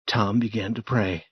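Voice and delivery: ordinary delivery, nothing marked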